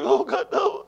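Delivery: high, distraught wail; imitated tearful wife. Wife phones husband in tears, high acted crying. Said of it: "She was shaking up, tear, crying".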